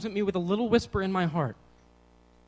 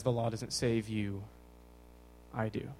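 quietly lowered, unhurried quote of God; soft divine reassurance; 6 semitones lower, 5 dB quieter than usual. Retold or imitated. Quotes God, softly answering the teacher.

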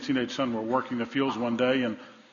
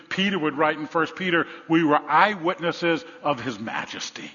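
raised, loud, emphatic preaching; full-force preaching; 3 semitones higher, 4 dB louder than usual. Real, not acted. Preacher proclaims the glimpse of glory, full-force preaching.

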